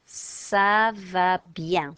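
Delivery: "ça va bien" drawn out slowly, sa va byan; slow French ça va bien. Shown as a demonstration. Teacher demonstrating feeling well in French, slow and clear. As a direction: stretch "ça va bien" for learners to copy. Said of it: "we've taken ça va".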